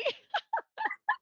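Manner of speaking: high, loud burst of laughter; embarrassed laugh. Real, not acted. Host laughing after apologising to guest, high laugh. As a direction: crack up at your own gaffe. Laughs at her own gaffe.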